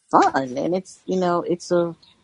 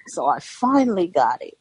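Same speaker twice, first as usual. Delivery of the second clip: normal talking voice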